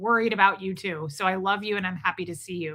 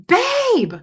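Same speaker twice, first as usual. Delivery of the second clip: high, loud yell down the street; retold screaming; 9 dB louder than usual. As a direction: holler it to someone blocks away. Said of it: "And I'm screaming in Ecuador".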